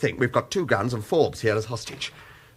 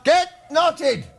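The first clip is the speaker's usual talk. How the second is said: high, loud yell of defiance; a cornered man shouting back at his captor; defiant shout; 4 dB louder than usual. Acted. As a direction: hurl it at the enemy. Defying captor again.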